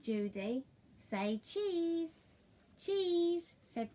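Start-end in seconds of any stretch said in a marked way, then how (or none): 1.0-2.4 say cheese. Acted. Judy calling for a photo. 2.8-3.5 cheese. Acted. Paddington smiling for a photo.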